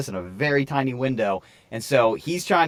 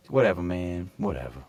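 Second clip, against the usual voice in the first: low, soft, deflated mutter; man giving up on the driver, low and soft; disappointed; 7 semitones lower, 6 dB quieter than usual. Retold or imitated. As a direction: let it drop in defeat. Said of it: "He's like".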